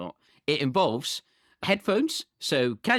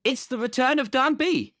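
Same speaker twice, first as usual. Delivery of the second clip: podcast announcer; 3 semitones higher, slower than usual. Acted. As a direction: announce the last guest with big mock grandeur. Announces cast member.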